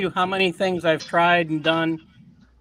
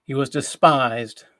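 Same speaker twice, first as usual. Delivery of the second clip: solemn scripture reading; 3 semitones lower than usual. Acted. Host reads of the man of sorrows, solemn scripture reading. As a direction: read the prophecy gravely, phrase by phrase. Reads Isaiah fifty-three aloud.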